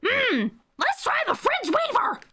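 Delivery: super high-pitched silly voice; squeaky high cartoon clown; Toad clown voice. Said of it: "A clown voice, Toad".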